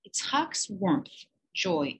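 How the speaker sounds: ordinary delivery, nothing marked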